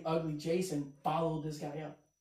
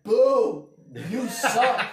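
high, loud, laughing boo; mock booing; 5 semitones higher, 10 dB louder, much slower than usual. The crowd jeering the comic, loud mock boo. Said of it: "they were like".

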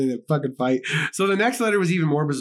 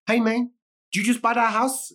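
lightly voiced, higher-pitched passerby; passing stranger; 8 semitones higher than usual. Man on a bike calls to new homeowners, higher-pitched mock stranger. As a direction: call it out higher, like a nosy passerby.

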